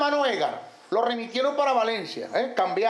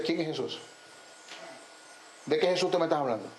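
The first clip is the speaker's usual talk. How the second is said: mock demon questioning; 7 semitones lower, 6 dB quieter, faster than usual. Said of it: "como un teatro".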